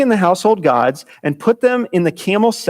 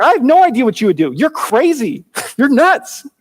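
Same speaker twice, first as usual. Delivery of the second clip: higher, quick, exasperated voice; incredulous Jacob; 7 semitones higher than usual. Retold or imitated. Jacob scoffing at Laban, fast exasperated disbelief. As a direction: rattle it off like he thinks Laban is mad. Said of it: "It's like".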